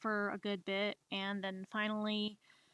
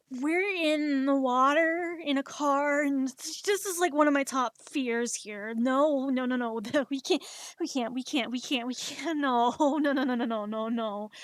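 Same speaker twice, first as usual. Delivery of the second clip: loud, high frantic protest with nervous laughs; panicked yelling; 4 semitones higher, 7 dB louder than usual. Retold or imitated. Passenger realising the car is sinking, loud high panic with laughs. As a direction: keep crying no, no, no in rising panic.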